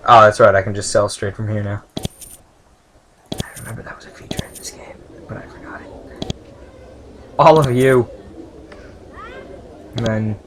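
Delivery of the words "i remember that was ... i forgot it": soft unvoiced whisper to the viewers; whispered aside. Real, not acted. Player confiding to viewers, a soft whisper. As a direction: murmur it like a secret aside.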